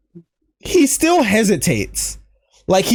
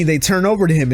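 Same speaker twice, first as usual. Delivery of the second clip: ordinary talk, nothing marked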